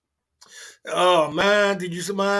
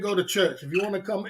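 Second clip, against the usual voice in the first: ordinary talk, nothing marked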